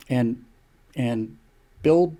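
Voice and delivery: ordinary talk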